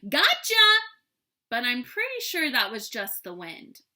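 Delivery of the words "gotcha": loud, high triumphant shout; dinosaur yell. A narrator's reading. Dinosaur springing on the child, loud high yell. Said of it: "a dinosaur yelling".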